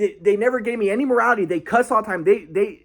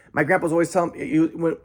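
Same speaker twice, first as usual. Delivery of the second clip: normal talking voice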